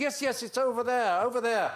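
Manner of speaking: quoted like a panicking hotel worker; flustered staff voice. Retold or imitated. Cleese voicing frantic staff member.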